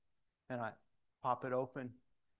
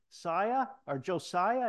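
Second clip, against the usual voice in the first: high, louder call across the room; calling out to son; 7 semitones higher, 10 dB louder, slower than usual. Retold or imitated. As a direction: call the little boy over, bright and loud. Dad calling his little son.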